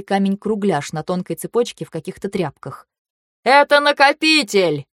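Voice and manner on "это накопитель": slow, high shout into an ear; shouting over wind. Acted. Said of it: "прокричал он на ухо, перекрикивая ветер".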